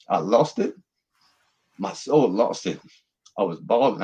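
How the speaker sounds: ordinary delivery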